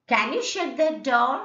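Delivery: "Can you shut that door" lifting up to "door"; questioning rise ending on "door"; rising tone. Shown as a demonstration. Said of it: "always it is rising tone".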